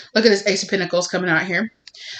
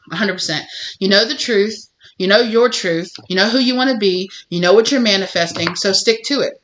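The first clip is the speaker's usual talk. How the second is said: ordinary talk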